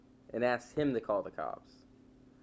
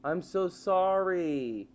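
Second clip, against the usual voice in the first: slow apology; 6 semitones higher, much slower than usual. Retold or imitated. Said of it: "he goes".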